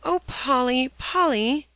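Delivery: high, loud pleading cry. A narrator's reading. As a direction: beg her not to go. Alexia pleading in distress.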